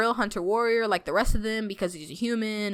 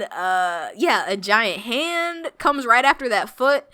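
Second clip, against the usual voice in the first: ordinary delivery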